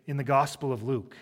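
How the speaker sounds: ordinary delivery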